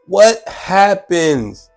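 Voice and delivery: ordinary delivery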